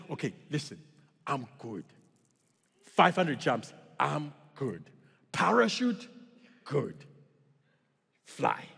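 sparse, halting pep talk with long pauses; deadpan foreign skydiving instructor; limited-English instructor. Acted. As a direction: few words, set out one by one. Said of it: "barely speak any English".

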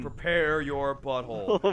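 dramatic villain voice. Pretend. Mock dramatic warning.

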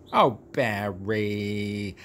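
slow, low, tender Oh, Barry; drawn-out fond sigh. Shown as a demonstration. Greets Barry lovingly like an old friend. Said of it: "the way I love to say Barry's name".

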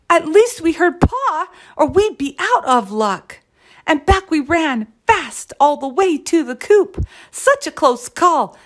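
high, excited little-chick chatter; exclaiming chicks. A narrator's reading. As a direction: blurt it out after a narrow escape. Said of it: "they exclaimed with a hoop".

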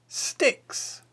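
ordinary delivery

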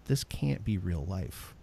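low, slow, quiet stunned disbelief; deadpan horror at what you hear. Retold or imitated. New gamer hearing the chat, low and stunned. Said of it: "you will literally just go".

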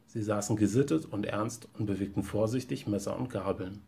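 ordinary delivery

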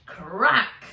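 ordinary delivery, nothing marked

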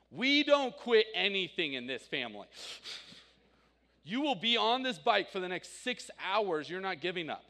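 loud, stern dad laying down the law; firm loud father refusing to let his daughter give up; full dad mode. Retold or imitated. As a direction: bark it like a dad who won't let her quit. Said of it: "I went full dad mode".

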